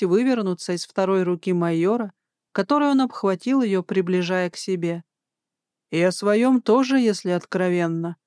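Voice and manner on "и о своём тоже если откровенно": usual speaking voice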